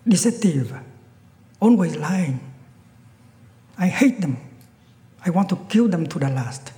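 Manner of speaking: furious killer ranting at all people; very angry. Acted. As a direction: spit out the hatred. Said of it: "he got very angry".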